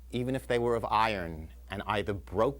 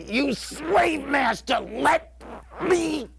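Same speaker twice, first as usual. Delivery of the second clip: high, full-voiced scream, slowly drawn out; stretched-out shout with a huge final word; yelled protest; 13 semitones higher, 6 dB louder, much slower than usual. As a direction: howl each word at the captor. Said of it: "while I'm yelling at him".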